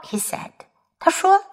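plain reading voice

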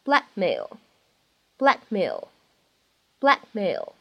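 "blackmail" with the k unreleased; incomplete plosion. Shown as a demonstration. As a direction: hold the k of "blackmail" before the m. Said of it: "它呢，也没有完全读出来".

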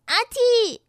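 high, loud acted sneeze. A narrator's reading. Rainbow's nose is tickled by tails, sneezing loudly. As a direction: burst out with a pretend sneeze.

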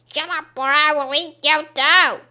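loud, high witch snarl; shouting witch. Acted. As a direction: shout her silent as the witch. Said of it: "shouts the witch".

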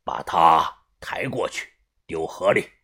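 cold low order. Acted. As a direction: order it without feeling. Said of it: "把头吩咐道".

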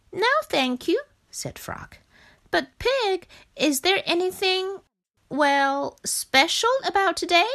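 a curious little frog asking; Frog's voice. Acted.

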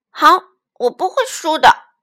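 high, loud, determined promise; eager loud child. Acted. Little dinosaur accepts the tyrannosaurus's race, loud and eager. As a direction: fire it back like a keen kid.

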